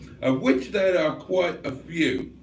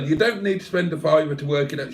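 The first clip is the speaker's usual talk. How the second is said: ordinary talk, nothing marked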